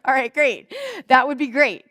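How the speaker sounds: mock enthusiastic. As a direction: pump up the approval a bit. Approving the intern fetching ice.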